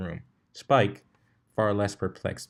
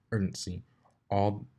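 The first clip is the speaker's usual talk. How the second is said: ordinary delivery, nothing marked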